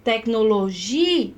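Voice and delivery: "tecnologia" slowed, leaning hard on "gi"; stress on gi. Shown as a demonstration. Teacher pointing out the tonic syllable, stretched stressed "gi" in "tecnologia". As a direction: hold and lift the "gi" in "tecnologia". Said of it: "A sílaba tônica é o gi".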